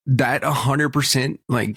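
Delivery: ordinary delivery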